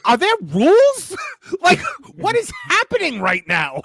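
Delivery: high, loud incredulous outburst; baffled cry, played up for laughs; mock bewilderment. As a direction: exclaim it in exaggerated disbelief. Retells bafflement at watching rugby.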